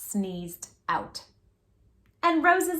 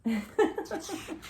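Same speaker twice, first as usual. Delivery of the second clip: amused laugh; 7 semitones higher, 6 dB quieter than usual. Real, not acted. Narrator laughs at story.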